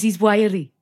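hammed-up Welsh accent. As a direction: imitate a Welsh lilt. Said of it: "He goes".

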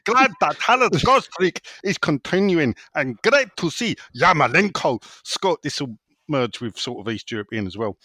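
a shaky attempt at a German accent. Reading a listener's question aloud in a mock German accent. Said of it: "read this in a German accent".

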